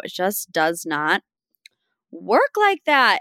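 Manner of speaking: slow, punched "work like that"; exasperated, drawn-out emphasis; emphatic. Real, not acted. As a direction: lean hard on every word. Emphatic scolding of Lenny.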